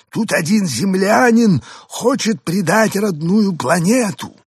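high, slow alien voice; drawn-out alien report. A narrator's reading. (высокий медленный голос пришельца)

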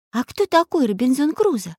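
high, curious character voice; wondering little Nastenka; surprised question. A narrator's reading. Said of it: "удивилась Настенька".

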